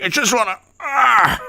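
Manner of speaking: high, strained mock voice; hammed-up posing impression; Tom Fitton. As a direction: trail into a strained groan, imitating him. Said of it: "but he's like".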